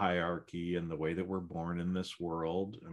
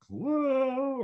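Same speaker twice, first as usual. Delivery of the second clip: whoa leaping far higher; surprised whoa; 6 dB louder than usual. Real, not acted. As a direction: gasp it out in astonishment. Surprise at being a grandfather.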